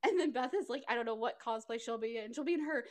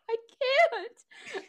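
high, loud, hammed-up wail; mock tearful refusal; 11 semitones higher, 5 dB louder than usual. Friend voicing Beth refusing in an imagined film scene. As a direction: overplay the heartbreak. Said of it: "she's like".

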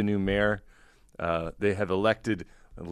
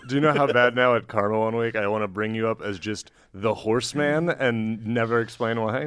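usual speaking voice

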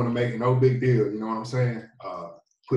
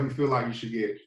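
usual speaking voice

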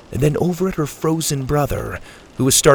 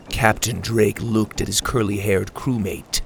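usual speaking voice

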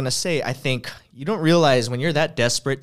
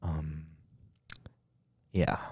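NPR-style host; 4 semitones lower, slower than usual. Pretend. Host playfully reopening the podcast after a break, NPR-style hush. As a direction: ease it down into hushed public-radio calm. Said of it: "do the whole episode just like that".

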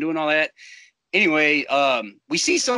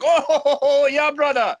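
put-on booming high African-accented back-slapping laugh; 10 semitones higher, 4 dB louder than usual. Nagia slapping friends' backs at the bar, African-accented hearty cheer. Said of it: "he had a really strong accent".

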